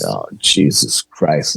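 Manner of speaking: low, slow, sinking dread. Retold or imitated. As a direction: mutter it low and slow as the fear lands. Sees red-eyed shadow behind them.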